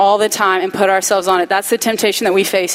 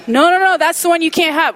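alarmed stop; 6 semitones higher than usual. Retold or imitated. God stops Eve.

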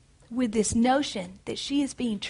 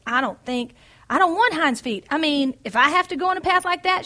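higher, louder pained protest; pained reluctance; 5 semitones higher, 5 dB louder than usual. A narrator's reading. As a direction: push back, hurting and unwilling. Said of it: "she said slowly and painfully".

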